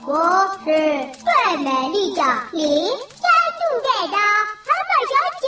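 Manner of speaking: rising, proudly loud self-introduction. Acted. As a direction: announce your name with pride, loudly. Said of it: "这么大声干嘛？".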